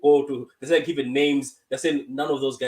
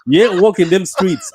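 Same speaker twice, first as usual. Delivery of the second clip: laughing; 3 semitones higher, 10 dB louder than usual. Retold or imitated. Loud laughing mock threat.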